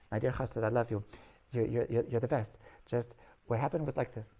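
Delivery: usual speaking voice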